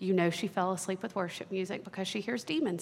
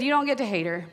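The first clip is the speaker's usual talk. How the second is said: louder, firm, no-nonsense tone; 4 semitones higher, 6 dB louder than usual. Retold or imitated. Father firmly telling his daughter no. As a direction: lay down the rule.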